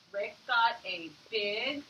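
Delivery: slow, word-by-word reading; sounding out a sentence slowly for the kids; tapping out each word. Shown as a demonstration. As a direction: read it slowly, one word at a time. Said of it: "Let's tap it out".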